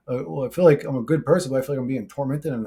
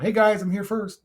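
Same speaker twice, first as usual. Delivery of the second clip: higher-pitched mock greeting; mock eager commenter; 7 semitones higher than usual. Guy mimicking a viewer announcing they're first, mock eager.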